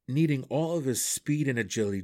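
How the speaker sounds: ordinary delivery, nothing marked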